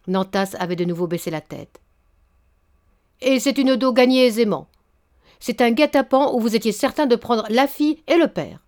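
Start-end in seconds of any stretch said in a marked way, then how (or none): 3.2-8.6 louder, cutting accusation; angry accusation. Acted. Baron accusing Nantas of a trap, louder.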